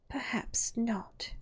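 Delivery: slow, hushed and careful; cautious whisper. A narrator's reading. Alice answering the Hatter in a cautious whisper. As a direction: whisper it warily. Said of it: "Alice cautiously replied".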